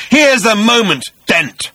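high, loud feverish shriek. A narrator's reading. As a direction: shriek it with hatred. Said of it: "shrieked the voice".

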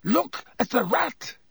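high, urgent desperate cry. A narrator's reading.

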